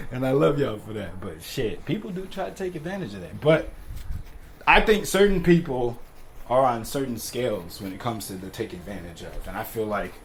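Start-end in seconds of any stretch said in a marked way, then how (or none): none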